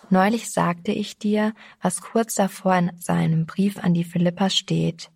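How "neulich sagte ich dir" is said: ordinary delivery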